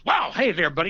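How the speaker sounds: high, loud mock buddy greeting; hearty greeting. Cagney greeting the casting guys, loud and high. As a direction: boom a fake-friendly hello. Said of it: "And he goes".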